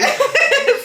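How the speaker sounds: high, loud laugh; high giggling laugh. Real, not acted. Friend repeats leprosy, high laugh. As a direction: giggle helplessly.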